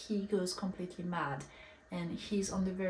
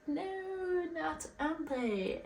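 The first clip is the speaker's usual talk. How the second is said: high wailing no, mock heartbroken; a hammed-up cry of grief at the news; a reader's playful high-pitched wail; mock dismay; 9 semitones higher, slower than usual. Reader reacting to Andrei's death.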